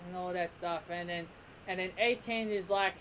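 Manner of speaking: ordinary talk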